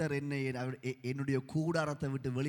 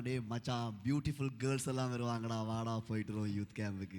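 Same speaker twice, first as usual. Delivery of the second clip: low, softer imitation of a friend; a hint of a coaxing friend, kept low; lightly voiced friend; 6 semitones lower, 4 dB quieter than usual. Friend invites him along to youth camp, lightly imitated. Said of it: "அவன் சொன்னான்".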